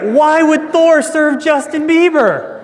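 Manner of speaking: loud, high, drawn-out exclamation; excited. Real, not acted. Preacher to congregation getting it, excited. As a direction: ask it loud and high, incredulous.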